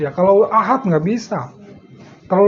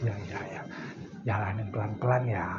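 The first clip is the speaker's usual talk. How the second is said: much softer, lower, giving-in teacher; soft yielding teacher; 12 semitones lower, 17 dB quieter, faster than usual. Retold or imitated. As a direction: gently let the stubborn student have his way. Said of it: "Kalau beliau". (guru mengalah dengan suara sangat pelan)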